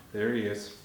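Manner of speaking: ordinary delivery, nothing marked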